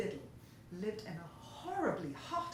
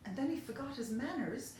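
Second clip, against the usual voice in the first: usual speaking voice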